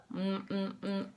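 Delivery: quiet low mm mm; closed-mouth chewing hum. Shown as a demonstration. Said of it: "Mouth closed".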